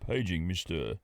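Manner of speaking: low, slow public announcement voice; airport paging announcer. Acted. Paging a passenger at the airport, slow announcer voice.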